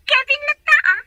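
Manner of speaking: high, loud shocked outburst; shocked exclamation. Acted. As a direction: blurt the name out in disbelief. Shocked exclamation at friend's news.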